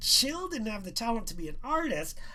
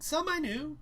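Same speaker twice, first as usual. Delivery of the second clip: plain reading voice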